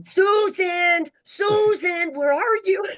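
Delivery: hammed-up boss hollering for her; calling out. Eddie on the walkie searching for Susan, mock calling out.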